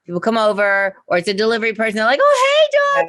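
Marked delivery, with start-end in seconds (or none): 2.2-3.1 very high, louder gushing hello; gushing visitor. Retold or imitated. Delivery person greeting the dog, very high and gushing. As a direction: coo at the dog like a stranger who loves dogs.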